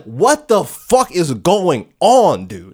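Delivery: hammed-up disbelief, dragging out the words; exasperated protest. Anne questioning the dead body, exasperated.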